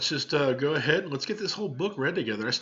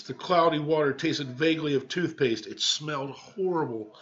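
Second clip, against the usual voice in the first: lively read-aloud storytelling; story narration; 4 semitones higher than usual. A narrator's reading. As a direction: read it to the class like a picture book.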